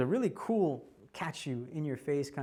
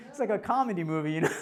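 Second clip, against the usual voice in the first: amused, raised voice through laughter; loud, higher-pitched giggly aside; laughing; 5 semitones higher, 7 dB louder, faster than usual. Real, not acted. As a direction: speak while cracking up. Cracking up at his own story.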